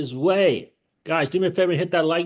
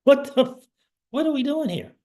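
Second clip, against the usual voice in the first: exasperated; 9 semitones higher, faster than usual. Real, not acted. Exasperated at the losses.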